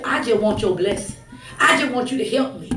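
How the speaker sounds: ordinary delivery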